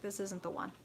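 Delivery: soft, understated inner voice; hushed, matter-of-fact intuition; tiny quiet voice. Acted. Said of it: "this tiny little quiet voice".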